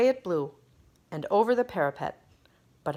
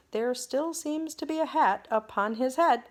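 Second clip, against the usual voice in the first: puzzled; 8 semitones higher than usual. Acted. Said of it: "looking puzzled".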